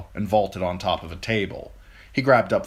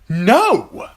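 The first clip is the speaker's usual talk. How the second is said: sudden high, loud angry cry; furious knight refusing the queen; 14 semitones higher, 10 dB louder than usual. A narrator's reading. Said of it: "Lancel was so angry".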